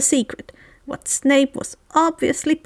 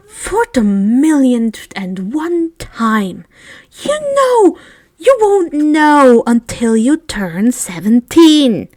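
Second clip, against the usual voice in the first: slow, weary, over-it voice; exasperated; 4 dB louder, slower than usual. A narrator's reading. Draco telling Colin again, exasperated and slow. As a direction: spell it out for the millionth time.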